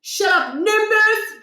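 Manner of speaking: high angry yell; furious teen shout. Acted. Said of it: "Josh shouted".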